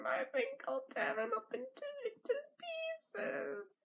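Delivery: hint of a gleeful villain; sly villain threat. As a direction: purr the threat.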